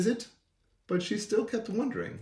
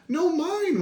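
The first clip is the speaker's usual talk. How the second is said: very high whining protest; whiny flea; 12 semitones higher, 3 dB louder than usual. A narrator's reading. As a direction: whine it like a tiny flea. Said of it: "whined the flea".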